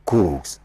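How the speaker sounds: Coups said low as "cooz"; S pronounced as Z. Shown as a demonstration. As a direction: end Coups on a Z sound, not S. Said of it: "it's pronounced as Z".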